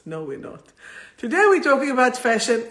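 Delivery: usual speaking voice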